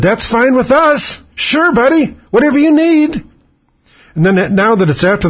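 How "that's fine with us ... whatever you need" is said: much higher, slow, simpering yes-man voice; mock eager agreement. Host mocking Democrats caving to Bush, high simpering voice. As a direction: fawn over him like a pushover. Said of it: "the Democrats said".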